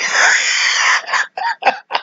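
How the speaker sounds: high, wheezing laugh; long real laugh running out of breath; helpless laughter. Real, not acted. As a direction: crack up helplessly. Laughs at the comment.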